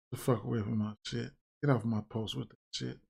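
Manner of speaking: irritated aside, muttered quickly; fast angry muttering. Real, not acted. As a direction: grumble it fast and low, nearly to yourself. Snapping at commenters under his breath.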